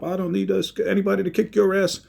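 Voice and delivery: high heated comeback; retold angry retort. Herm yells back at brother, heated mock shout. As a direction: fire it back in the fight.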